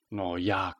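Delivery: a touch of awkward uncertainty; embarrassed. A narrator's reading. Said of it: "začala rozpačitě Sabina".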